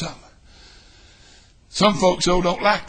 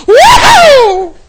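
high shouted woo; joyful whoop; 12 dB louder than usual. Retold or imitated. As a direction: let out a happy holler. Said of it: "I'll go".

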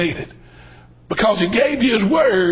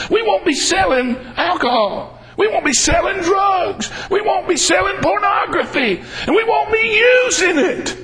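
preacher rallying the church, climbing higher each line; building preaching; 8 semitones higher than usual. Real, not acted. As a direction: climb with every repeat. Building preaching, repeated phrase.

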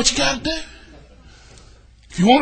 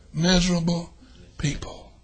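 drops to a hush; fading emphasis; 5 semitones lower, 8 dB quieter than usual. Real, not acted. Preacher letting the word sink in, hushed. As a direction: let it fall away to a breath. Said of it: "emphatic sermon fade".

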